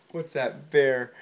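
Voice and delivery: plain reading voice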